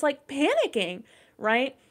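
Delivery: ordinary talk, nothing marked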